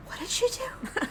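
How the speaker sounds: high, very soft shocked question; stunned hush. Retold or imitated. Kids reacting to aunt's spider story, stunned. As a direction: ask it in a tiny stunned voice. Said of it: "they were all stunned silent".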